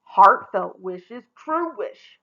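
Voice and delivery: slow, loud gruff growl. A narrator's reading. Bear godfather growling at Rella, slow and loud. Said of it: "growled a gruff voice".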